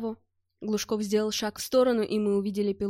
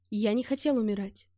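plain reading voice

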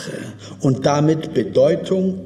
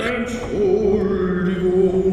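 slow, higher sung-out apology; bashful opera singer; 5 semitones higher, much slower than usual. Retold or imitated. Ashamed opera singer apologising after an aria, slow and higher. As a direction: croon it like a shy tenor. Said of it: "der sich nach jeder Arie entschuldigte".

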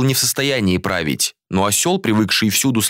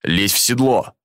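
barking order. A narrator's reading. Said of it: "гаркнул Джориан".

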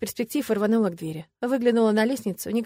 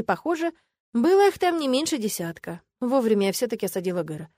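ordinary delivery

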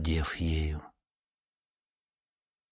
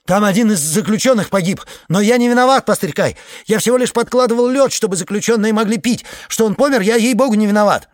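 urgent panting report; 11 semitones higher, 8 dB louder, faster than usual. Acted. Panicked iceman pleads innocence.

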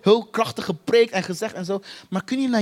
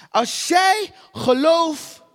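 1 emphatic plea; 6 semitones higher, much slower than usual. Acted. Preacher voicing Jesus' answer.

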